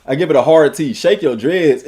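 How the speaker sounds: ordinary talk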